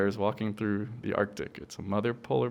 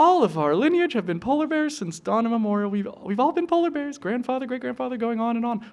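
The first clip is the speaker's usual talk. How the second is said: high, patient puppet mother voice; mother polar bear; 12 semitones higher, 3 dB louder than usual. Acted. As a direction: reassure the cub in a high puppet voice. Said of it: "And the mom goes".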